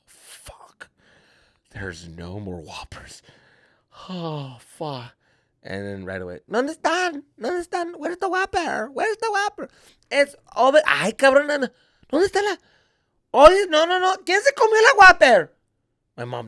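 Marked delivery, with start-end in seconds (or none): 0.1-5.1 slow, hushed realization; under-the-breath panic; whispered dread. Retold or imitated. Inner dread as burgers are requested. 6.5-15.5 high-pitched frantic searching voice; hammed-up high accusing voice; indignant relative. Relative hunting for missing Whopper, retold.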